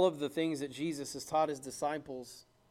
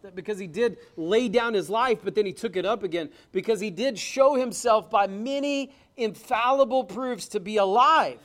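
louder, building fervent preaching; preaching at full force; 6 semitones higher, 10 dB louder than usual. Acted. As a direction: rouse the congregation, hammering each phrase.

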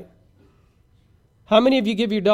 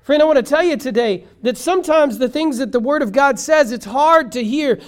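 raised, high-pitched sermon appeal; fervent preaching; 8 semitones higher, 6 dB louder than usual. Real, not acted. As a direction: press the point on the congregation.

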